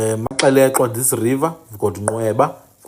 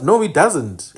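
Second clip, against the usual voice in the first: ordinary delivery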